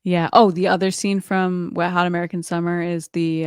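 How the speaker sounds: ordinary delivery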